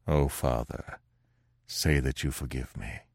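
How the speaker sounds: low, soft begging Pierre; pleading son. Acted. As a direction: beg forgiveness on your knees, hushed. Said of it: "he exclaimed".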